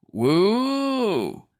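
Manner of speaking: high startled yelp; sudden scared cry; startled whoop. Acted. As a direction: yelp in fright.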